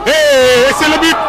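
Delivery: stretched-out goal shout; long goal yell. Real, not acted. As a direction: roar out the goal. Goal called by commentator.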